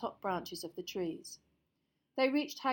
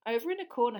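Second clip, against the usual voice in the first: usual speaking voice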